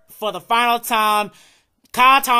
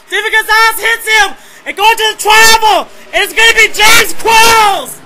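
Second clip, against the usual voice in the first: high, loud shouted call of the photo finish; shouting the final lap to the checkered flag; finish-line yell; 12 semitones higher, 14 dB louder than usual. Real, not acted. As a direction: scream the winner across the line.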